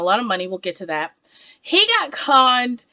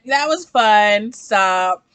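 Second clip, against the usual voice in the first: ordinary talk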